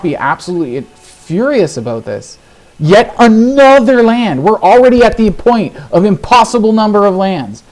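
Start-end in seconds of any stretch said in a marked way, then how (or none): 2.8-7.6 exasperated outburst. Real, not acted. Player protesting yet another land draw, exasperated. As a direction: raise the pitch in loud exasperation.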